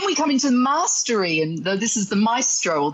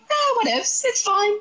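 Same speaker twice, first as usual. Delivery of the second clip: a much higher, breezy mock shrug; whatever attitude; 11 semitones higher than usual. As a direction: toss it off like you don't care. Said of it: "a little bit of a whatever attitude".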